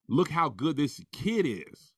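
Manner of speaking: ordinary talk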